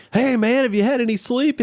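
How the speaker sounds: very high, excited TV host impression; Strahan, hyped up. Excited morning-show host interviewing the winning quarterback, hyped up. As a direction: imitate a host bubbling over with energy. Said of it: "Strahan is all hyped up and all excited".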